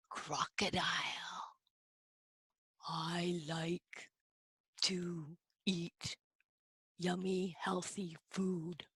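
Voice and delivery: very soft, low, near whisper; hushed. Pretend. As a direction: hush it, word group by word group. Hushed reveal beside the crocodile.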